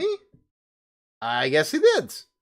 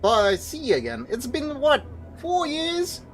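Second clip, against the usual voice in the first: put-on higher, eager reunion voice of an old pal; old friend glad to see you again, voiced higher; surprised old friend; 8 semitones higher than usual. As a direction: greet a long-lost friend with higher delight. Voices excited old friend NPC.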